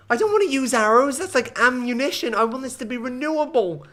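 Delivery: high-pitched, exasperated objection; whiny protest. Real, not acted. Streamer rejecting arrows as ammunition, high whiny protest. Whining at an unwanted suggestion.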